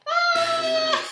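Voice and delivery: high squeal into a laugh; pretend shriek and laugh. Storyteller laughs on cue at the silly rhyme. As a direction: act like it's the silliest thing ever. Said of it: "laugh like it is the silliest".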